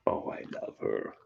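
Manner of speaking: soft whisper, breath only; whispered aside. Real, not acted. Owner whispers her love for the dog to the viewers. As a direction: whisper it fondly as a secret.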